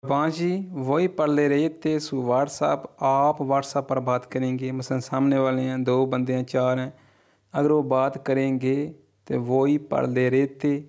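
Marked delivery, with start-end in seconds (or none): 0.8-2.8 very slow, each Italian word laid out clearly; slow model reading. Shown as a demonstration. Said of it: "teacher models Italian sentence". 9.3-10.8 very slow, each Italian word laid out clearly; slow model reading. Shown as a demonstration. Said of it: "teacher models Italian phrase".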